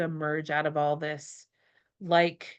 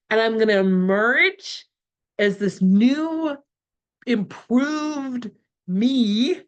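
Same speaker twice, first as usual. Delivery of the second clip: excited; 4 semitones higher, 4 dB louder, slower than usual. Real, not acted. Emerging as a new self.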